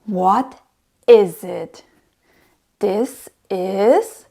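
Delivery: normal talking voice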